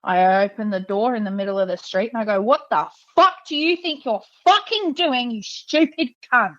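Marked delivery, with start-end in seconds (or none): 2.4-6.5 retold angry yell. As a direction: replay the fury at the old driver, pitched up. Retold road rage confrontation.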